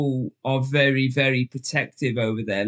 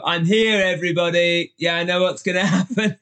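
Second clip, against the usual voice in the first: higher and louder, a hammed-up announcement to the room; mock grand entrance; 4 semitones higher, 4 dB louder than usual. Guest bursting into a bar, announcing himself to everyone, hammed-up and loud. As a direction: burst in like you own the bar. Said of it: "open the doors and go".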